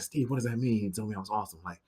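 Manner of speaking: anxious. Retold or imitated. Anxious quiet questioning.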